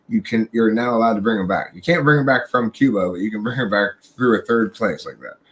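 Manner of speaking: usual speaking voice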